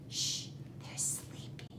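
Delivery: a soft shh over a sleeping nest; hushing whisper. Pretend. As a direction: whisper softly so birds sleep.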